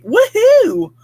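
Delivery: high, loud woohoo; excited cheer. Real, not acted. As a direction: cheer for the viewer's good news. Cheering viewer's great sleep.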